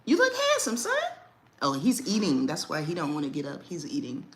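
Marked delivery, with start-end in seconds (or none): 0.0-0.9 much higher, sing-song compliment; high cooing praise. Real, not acted. Mother praising her son on camera, high sing-song coo. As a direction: coo it high and sweet at him.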